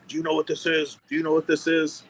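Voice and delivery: high-pitched mock yell; retold yelling. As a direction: imitate an irate official demanding an answer. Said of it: "he's yelling at me".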